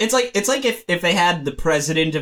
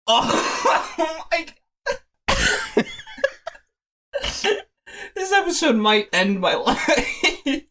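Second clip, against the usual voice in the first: breathless, high helpless laughing; disgusted laughter; 10 semitones higher, slower than usual. Real, not acted. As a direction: laugh till you can't breathe. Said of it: "there's phlegm in their throat".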